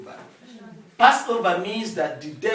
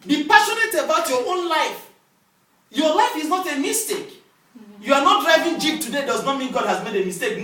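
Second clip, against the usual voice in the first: high, emphatic sermon voice; insistent pulpit proclamation; forceful preaching; 7 semitones higher, 5 dB louder than usual. Real, not acted. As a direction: exhort the congregation with raised pitch.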